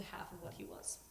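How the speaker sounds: whispering through tears; choked, almost voiceless; tearful whisper. Real, not acted. Eulogy ending in tears.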